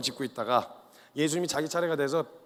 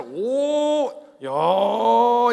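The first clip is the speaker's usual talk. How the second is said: long, high, loud whoops of admiration; impressed praise; 6 semitones higher, 8 dB louder, much slower than usual. Acted. Jesus praising Peter's confession, impressed cheer. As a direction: cheer him with drawn-out, rising admiration. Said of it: "이러면서 칭찬을 받아요".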